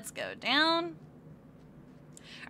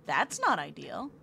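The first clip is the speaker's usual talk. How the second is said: usual speaking voice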